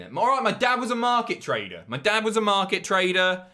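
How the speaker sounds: high, loud insistence; loud defensive boast. Real, not acted. Streamer arguing his working-class roots to viewers, loud and insistent. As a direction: hammer it out as proof.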